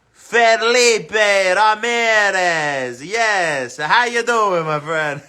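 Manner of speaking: a light mock Italian Spanish accent, drawn out; loud, drawn-out Italian Spanish accent for the name; playful Italian Spanish accent. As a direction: greet the viewer in a hint of Italian Spanish accent. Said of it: "the Italian Spanish accent today".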